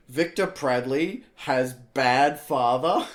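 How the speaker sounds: slow, loud headline announcement; mock headline. Host imagining headlines about his dad, slow mock-dramatic announcement. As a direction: announce a fake scandal, drawing out each word.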